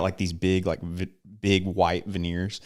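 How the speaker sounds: ordinary delivery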